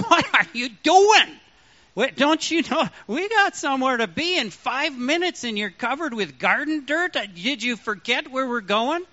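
high, loud startled what are you doing; half-laughing alarm at the muddy wife; exasperated surprise. Retold or imitated. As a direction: caught off guard, voice jumping up. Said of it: "a challenging accusation".

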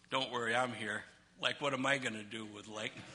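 low, hushed reassurance with a comic aside; soft and low, playing the steady father; mock-calm dad. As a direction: drop to a quiet deadpan. Plays himself reassuring the children.

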